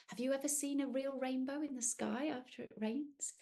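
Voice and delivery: ordinary delivery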